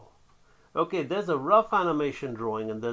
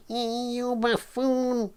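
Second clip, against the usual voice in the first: very high, drawn-out cartoon voice; cartoon villain insult; 10 semitones higher, much slower than usual. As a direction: stretch it slowly in a high, put-on squeal. Acting out a cartoon character.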